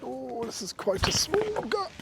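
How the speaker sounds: high, slow, strained with effort; strained squeezing. Real, not acted. Walker squeezing through a small gap, high strained voice.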